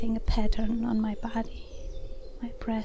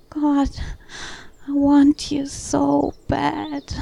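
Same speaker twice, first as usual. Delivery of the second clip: louder, slow, aching plea; impassioned pleading; 4 semitones higher, 6 dB louder, slower than usual. Acted. Woman telling her lover of her longing, impassioned. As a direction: plead with raised voice, drawing the words out.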